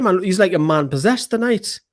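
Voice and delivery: usual speaking voice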